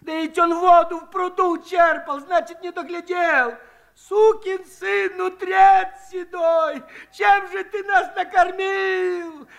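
high, loud, slow shrill squeal of rage; shrieking woman. A narrator's reading. Aniska shrieks at Shchukar, high and furious. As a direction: screech at the old man. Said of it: "взвизгнула Аниська".

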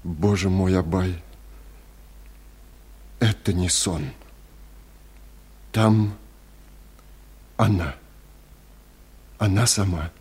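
hushed, halting, with long gaps; stunned, barely getting the words out; breaking voice. A narrator's reading. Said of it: "заговорил прерывающимся голосом". (тихо, прерывающимся голосом)

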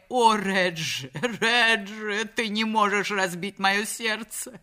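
high, slow, tearful-sounding begging; voiced wronged wife imploring; pleading wife. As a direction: beg him to come back. Wife begs husband to return.

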